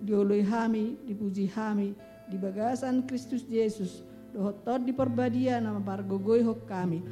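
ordinary talk, nothing marked

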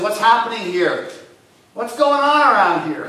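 Bartimaeus calling out. Acted. Blind beggar by the road, calling out loud. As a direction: shout it like you can't see what's going on.

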